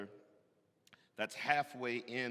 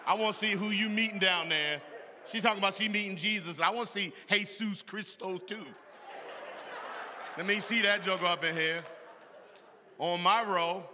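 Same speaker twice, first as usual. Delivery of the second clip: suspicious husband; 7 semitones higher, 7 dB louder than usual. Retold or imitated. Suspicious husband checking out church.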